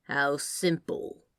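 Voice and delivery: slow, dry, unimpressed; deadpan the sarcasm slowly. A narrator's reading. Crowley mocks the angel's swap plan, slow dry sarcasm. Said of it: "Crowley said dryly".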